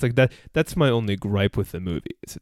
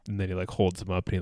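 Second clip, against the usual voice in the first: normal talking voice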